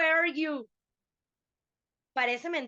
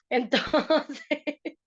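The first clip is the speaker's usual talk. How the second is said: amused, louder and broken up by laughter; laughing; 2 semitones higher, 5 dB louder than usual. Real, not acted. Teacher resuming class after students' banter, laughing. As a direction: laugh through the word.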